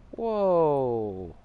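falling, amazed whoa; surprised whoa. Real, not acted. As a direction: react in loud disbelief. Reacting in disbelief.

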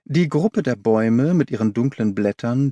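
usual speaking voice

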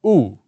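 loud ooh swooping down in pitch; sliding ooh. Shown as a demonstration. Coach demonstrating the vocal roller coaster. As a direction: ride the sound down like a roller coaster. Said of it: "and you go".

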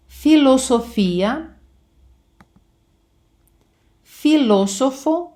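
"філософо" slow, stress on the second syllable; "філософія" drawn out, stress on the Spanish syllable; Spanish stress shown. Shown as a demonstration. Teacher shows Spanish stress to her students. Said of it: "звертайте увагу на наголос".

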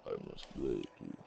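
very low, quiet menacing voice; deep Russian boxer voice. Retold or imitated. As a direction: rumble it low and cold. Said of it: "He said".

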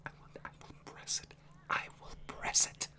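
hushed whisper to himself. Real, not acted. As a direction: whisper it under your breath. Whispering about pressing a button.

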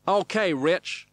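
high, loud cowboy coach; stunt wrangler. A narrator's reading. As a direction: holler instructions, stretching the words.

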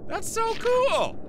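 high, slow, drawn-out squeal of delight. Real, not acted. Gamer thrilled that the puzzle clicked, delighted. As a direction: stretch out the joy. Puzzle pieces fit together.

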